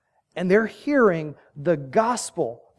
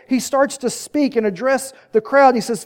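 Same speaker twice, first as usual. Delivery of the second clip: usual speaking voice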